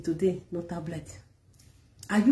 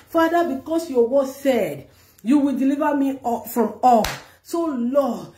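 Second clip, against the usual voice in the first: fervent prayer; 4 semitones higher than usual. Acted. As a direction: lead the prayer with full fervour. Leading loud prayer.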